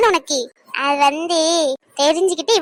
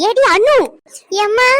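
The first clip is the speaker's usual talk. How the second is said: loud, higher call up the stairs; 5 semitones higher, 4 dB louder, slower than usual. Acted. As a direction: summon her from another room. Mother calling daughter upstairs. (மகளை உரக்க அழைத்தல்)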